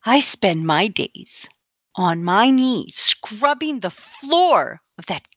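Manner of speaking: slow, low, measured anger; seething restraint. Acted. Church scrubber answers her accuser, seething and deliberate. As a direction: spell out the grievance slowly and deliberately.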